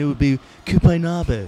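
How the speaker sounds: Cupenabo in an English accent, the Spanish place name anglicized. Shown as a demonstration. Joking to his podcast hosts, showing the place name in an English accent. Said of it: "If I said it with an English accent".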